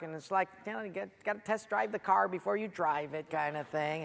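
ordinary talk

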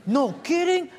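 high, indignant wife; wife siding with husband, high and indignant; wife's voice. Retold or imitated. Wife voiced in retold scene.

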